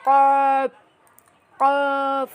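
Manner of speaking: thick, full কপ from the back of the tongue; Arabic letter demo. Shown as a demonstration. Teacher showing students a heavy কপ. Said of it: "মোটা করে পড়তে হবে এভাবে".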